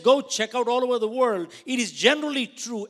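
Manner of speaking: loud, high, insistent manager; stern manager voice. Retold or imitated. Manager lecturing the fired agent, loud insistent voice. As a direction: drive the point home loudly.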